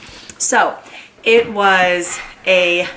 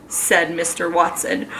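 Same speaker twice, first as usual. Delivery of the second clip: usual speaking voice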